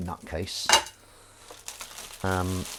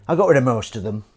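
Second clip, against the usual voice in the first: louder with stress on got; louder, triumphant; 9 dB louder than usual. Shown as a demonstration. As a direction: proudly announce got rid. Repeated louder.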